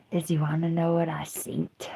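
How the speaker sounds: unvoiced conspiratorial whisper. Real, not acted. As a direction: lean in and whisper it. Confides a secret.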